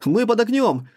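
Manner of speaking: high, loud panicked yell; panicked under fire. Acted. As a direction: shout over the noise in panic. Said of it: "заорал в ответ пилот".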